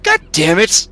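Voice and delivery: loud, furious outburst; angry shout. Acted. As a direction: yell it in frustration. Character kicks the dragon.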